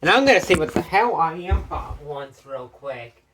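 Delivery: usual speaking voice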